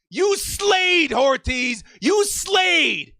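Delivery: high shouted praise, all-out. Real, not acted. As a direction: yell it to the rooftops. Praising the general manager.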